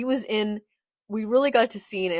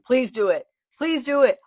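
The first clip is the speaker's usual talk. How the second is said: loud plea, higher on the repeat; eager begging; 6 semitones higher, 10 dB louder than usual. Real, not acted. Fan begging Whitebeard to kill Teach, eager pleading. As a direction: urge it on with mounting excitement.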